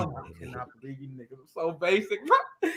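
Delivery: laughing through the words; cracking up in disbelief. Retold or imitated. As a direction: laughs out the scorn. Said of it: "I was like".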